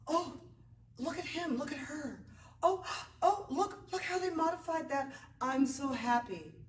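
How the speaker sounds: high, gushing delight over a student; high, thrilled fellow students gushing. Retold or imitated. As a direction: coo with excited delight. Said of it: "They're gonna be like".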